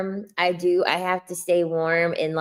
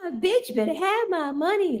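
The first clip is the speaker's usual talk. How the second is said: sassy song quote; 11 semitones higher than usual. Pretend. Quoting song line playfully.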